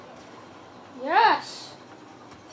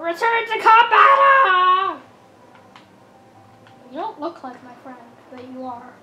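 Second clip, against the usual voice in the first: ordinary delivery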